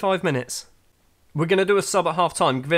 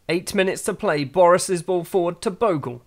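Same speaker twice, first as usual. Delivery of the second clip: normal talking voice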